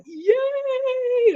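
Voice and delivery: a high squealed yay, far from his own voice; mock cheer. Ski resorts cheering the new February long weekend, high squealed mock cheer. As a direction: squeal it like a thrilled crowd. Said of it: "The ski resorts in Alberta went".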